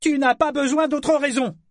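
furious spat retort. A narrator's reading. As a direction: spit the words in rage. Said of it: "cracha-t-il fou furieux".

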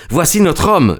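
sudden high alert call; urgent exclamation. A narrator's reading. Watson alerting Holmes to the cyclist. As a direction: point him out urgently. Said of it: "lui dis-je".

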